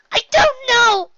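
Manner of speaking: very high, slow, loud cry; wailing outburst. A narrator's reading. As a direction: wail it out as a distraught filly. Frightened Nyx's outburst continues.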